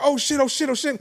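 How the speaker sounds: high, repeated cries of alarm; mock panic. Storyteller reliving his bad high, mock panicked and high.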